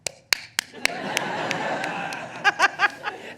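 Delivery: high gleeful shriek of laughter; giddy delighted laugh; squealing laugh. Retold or imitated. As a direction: mimic a crowd's thrilled squeal. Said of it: "all of the women in the audience just went".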